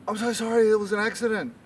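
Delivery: high-pitched, pleading panic; panicked apology. Acted. Uncle Barry pleading with bodyguard, high-pitched panic.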